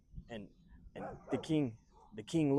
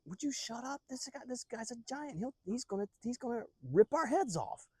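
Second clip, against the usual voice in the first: high, strained urgent warning; hammed-up fear, pitched way up; panicked hushing; 11 semitones higher than usual. Soldiers hush David about the giant, panicked.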